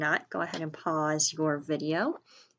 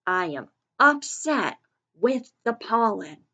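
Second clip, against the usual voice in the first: higher and louder, really upset; with an exclamation point; 5 semitones higher, 7 dB louder than usual. Shown as a demonstration. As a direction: read it with strong feeling. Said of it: "if we're really upset".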